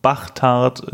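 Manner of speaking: Bachtard sehr langsam, mit ch. Shown as a demonstration. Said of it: "Sollte da stehen Bastard oder". (Bachtard langsam mit ch)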